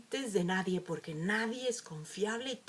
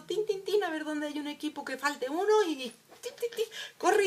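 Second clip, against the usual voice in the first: quick tin sounds; 5 semitones higher than usual. Retold or imitated. Child quickly finds a seat.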